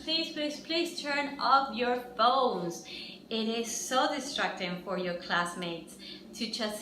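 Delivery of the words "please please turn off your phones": ordinary talk, nothing marked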